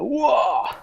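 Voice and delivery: high amazed wow; excited wow. Acted. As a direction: gasp out a child's wow. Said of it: "comme dans une pub de jouets". (wouah émerveillé)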